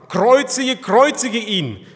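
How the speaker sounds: loud, high shout demanding death; crowd's cry. Acted. Priests and servants shout at Pilate for crucifixion, crying out. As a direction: cry it out like an angry mob. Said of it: "schrien sie und sprachen".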